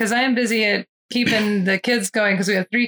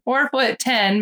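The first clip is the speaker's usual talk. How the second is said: ordinary talk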